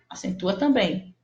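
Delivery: ordinary talk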